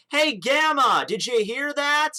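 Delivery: high, loud mock shout; calling out. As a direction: holler to someone far away, stretching the question upward. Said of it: "What are you shouting at him for?".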